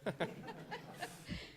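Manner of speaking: a short breathy laugh; a light rising laugh before speaking; nervous laugh. Real, not acted. Speaker laughs on being called to the microphone.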